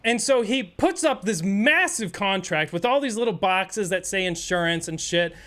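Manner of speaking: ordinary talk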